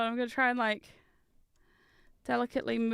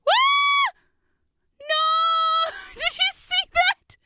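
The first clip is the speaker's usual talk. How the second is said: much higher, loud shriek then laughing no; startled shriek; 7 dB louder, much slower than usual. Real, not acted. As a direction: yelp as the piece goes flying. Bat piece flies off.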